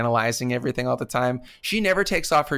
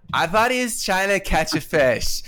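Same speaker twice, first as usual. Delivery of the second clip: laughing through a quote; 5 semitones higher than usual. Retold or imitated. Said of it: "gives her the stink face and goes".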